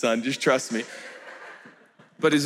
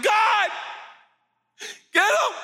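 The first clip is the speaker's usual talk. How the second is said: vengeful plea. Acted. Angry believer begging God to get his enemies. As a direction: demand God punish the Christians.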